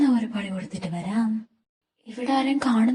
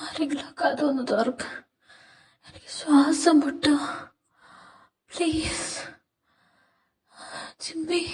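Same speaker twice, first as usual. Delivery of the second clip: faint, breathless, softer pleading; suffocating plea; 2 semitones higher, 4 dB quieter than usual. Acted. Short of breath behind the locked door, he weakly begs someone to open it. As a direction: gasp the words out as the air runs out.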